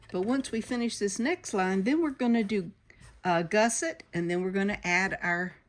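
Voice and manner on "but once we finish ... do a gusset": ordinary delivery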